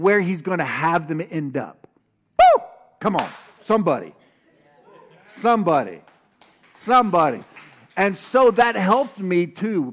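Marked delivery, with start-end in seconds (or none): 2.4-7.4 louder with each call of somebody; rousing call. Real, not acted. Preacher rousing congregation, each somebody louder. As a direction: whip up the room, building.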